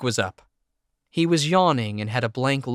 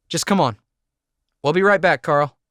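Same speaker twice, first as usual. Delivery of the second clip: urgent; 5 semitones higher, 6 dB louder than usual. A narrator's reading. Hurrying Jake away urgently.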